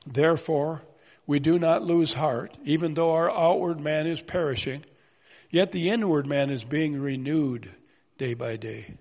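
a formal pulpit reading of the text; scripture reading. Acted. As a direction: proclaim the verse to the church. Reads scripture aloud.

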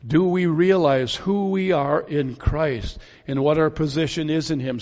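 normal talking voice

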